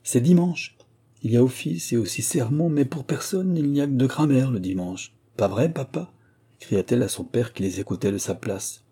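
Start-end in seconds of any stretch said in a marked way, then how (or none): 0.0-6.1 put-on high-pitched, lively girl's chatter; cheeky younger daughter, arguing playfully. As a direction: argue back in a higher, girlish voice. (jeune fille espiègle, voix plus aiguë)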